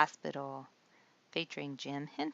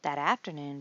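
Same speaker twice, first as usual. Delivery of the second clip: ordinary delivery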